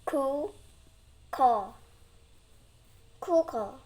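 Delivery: loud syllables then blended word; sounding out syllables. Real, not acted. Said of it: "I'm gonna read".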